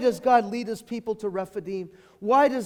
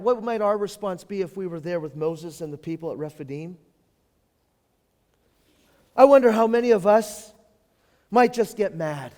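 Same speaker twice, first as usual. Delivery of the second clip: usual speaking voice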